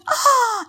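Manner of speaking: loud, high storybook gasp; shocked gasp. Acted. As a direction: gasp in mock horror. Rabbit hears the alarming news. (grito ahogado de susto, agudo)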